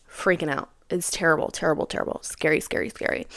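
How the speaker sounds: whispered, fading almost to nothing; hushed whisper. Retold or imitated. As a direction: breathe the words out as if still shaken. Retelling a frightening panic attack.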